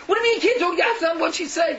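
high, loud, exasperated insistence; agitated demand. Acted. Caged bird presses the returning messenger for news, agitated demand. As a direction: demand an answer, voice shooting up.

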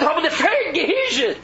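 high, drawn-out mock cry of a preacher; imitated crying scream. Rabbi crying out about Elul from the bimah, imitated scream. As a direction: stretch it into a screamed lament, imitating him. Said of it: "cry and scream".

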